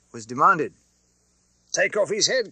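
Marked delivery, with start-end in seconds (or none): 1.7-2.5 higher, louder shout for his head; crowd's cry. Acted. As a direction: call out like a baying mob. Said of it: "cried the audience".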